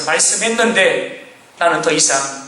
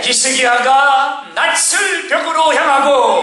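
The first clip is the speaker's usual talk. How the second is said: slow, high declamation of scripture; full-force preaching; 8 semitones higher, slower than usual. Real, not acted. Preacher proclaiming the king's turn to the wall, slow full-force preaching. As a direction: stretch each word out in a raised preaching cry.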